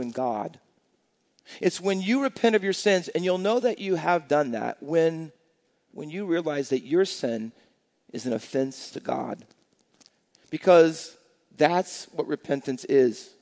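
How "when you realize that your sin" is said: normal talking voice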